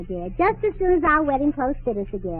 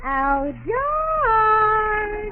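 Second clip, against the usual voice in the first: long, high call for her husband; 6 semitones higher than usual. Acted. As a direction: sing out the name, drawn out and rising. Wife calling out to husband.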